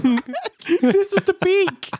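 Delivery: high, slow, laughing through it; giggling while saying it. Real, not acted. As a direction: crack up mid-sentence.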